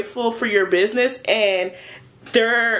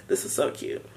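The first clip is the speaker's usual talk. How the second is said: hushed gush; 8 semitones lower, 9 dB quieter than usual. Real, not acted. Gushing over the cute card.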